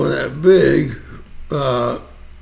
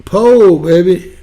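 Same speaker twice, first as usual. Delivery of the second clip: excited; 3 semitones higher, 5 dB louder, slower than usual. Real, not acted. Excited call about the poll.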